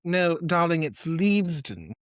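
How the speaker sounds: lightly voiced English correction, slowed down; posh British correction. Co-host correcting him in a light British accent. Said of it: "if I had done that in an accent".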